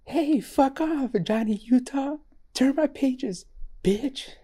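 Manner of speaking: mock your friend's squeaky impression; impersonating co-host. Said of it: "That was me impersonating you".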